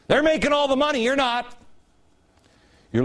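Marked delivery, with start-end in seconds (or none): 0.1-1.5 mock propaganda pitch. Host mocking the class-war message to viewers, sarcastic and high. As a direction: parrot the line they want you to believe.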